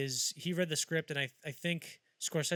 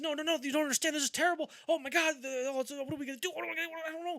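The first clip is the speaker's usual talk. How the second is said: freaking out; 13 semitones higher, faster than usual. Retold or imitated. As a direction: imitate Marty hitting the panic button. Marty panicking about being late.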